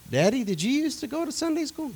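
put-on high, wide-eyed child asking his daddy; little boy voice. Boy asks his father about church, high child voice. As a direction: play the curious young son, pitched high.

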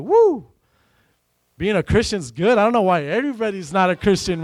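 excited woo then beaming; joyful whoop. Retold or imitated. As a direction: cheer it like you just struck gold. Joy at getting big contracts.

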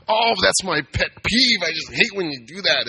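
hammed-up pet-peeve whine; mock complaining coworker. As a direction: mimic a coworker's fussy gripe.

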